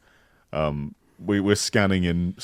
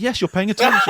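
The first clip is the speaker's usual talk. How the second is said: much higher, laughing, pleased; a wife delighted he listened, giggling; 5 dB louder than usual. Pretend. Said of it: "It's like".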